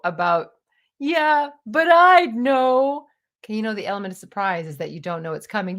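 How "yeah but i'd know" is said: higher, loud, slow whine; whiny ego. Retold or imitated. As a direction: stretch it out stubbornly. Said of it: "my ego".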